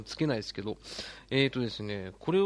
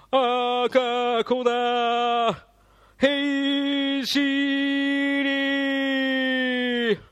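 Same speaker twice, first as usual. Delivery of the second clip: very slow, high, loud drawn-out call; K-1 ring announcer; 12 semitones higher, 8 dB louder, much slower than usual. Shown as a demonstration. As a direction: stretch every vowel like a ring announcer. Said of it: "ケーワンっぽく呼んでみる".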